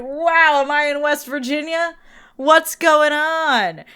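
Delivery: mocking the trucker's pickup truck, mock-stunned and slow; mock disbelief. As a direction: act faux-shocked, drawing out the last question.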